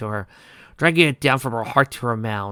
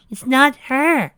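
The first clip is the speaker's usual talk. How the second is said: eerie slow, higher; 7 dB louder, much slower than usual. Acted. Pinky repeats.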